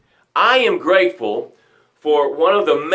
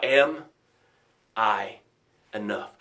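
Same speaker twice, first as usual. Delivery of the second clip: low, halting question fading softer; self-doubting question; 7 semitones lower, 7 dB quieter, slower than usual. Acted. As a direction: let the doubt sink in, word by word, dropping away. Labels turn affirmation into doubt.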